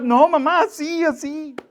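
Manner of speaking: put-on high, loud, exasperated child's plea; a boy losing patience with his mother; desperate little boy. The frustrated child pleading with mom, high and desperate. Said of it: "el niño se empezaba a desesperar".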